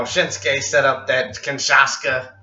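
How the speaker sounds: slow Southern drawl, imitated; hammed-up Southern accent, drawn out at the end. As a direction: mimic a Texan wrestler's promo. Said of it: "But that southern accent".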